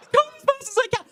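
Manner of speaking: high squealed protest of someone going flat out; strained high reply. Acted. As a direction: answer in a squeal mid-sprint. Acts the rider straining to answer.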